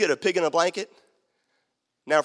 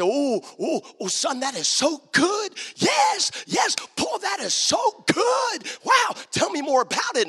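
excited dad; 11 semitones higher, slower than usual. Retold or imitated. Dad praising his son's Bible lesson, thrilled and high. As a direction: gush over the son's lesson. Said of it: "And I say".

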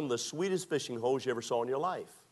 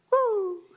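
high, loud cheer; excited high whoop; victory whoop; 6 dB louder than usual. Retold or imitated. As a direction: whoop like you just won. Celebrating catching the biggest fish.

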